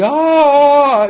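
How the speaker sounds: high, loud cry of pain; pained scream. Retold or imitated. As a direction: yelp as the needle goes in. Needle into knee.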